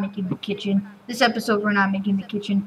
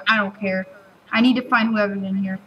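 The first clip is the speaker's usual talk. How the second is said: ordinary talk, nothing marked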